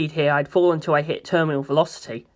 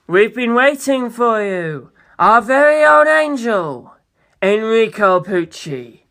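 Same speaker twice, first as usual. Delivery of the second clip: slow, high and louder, savoring each word; grand greeting; 4 semitones higher, 4 dB louder, slower than usual. Acted. Funny Valentine grandly welcoming Pucci, slow and lofty. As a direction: welcome him like a long-awaited messiah.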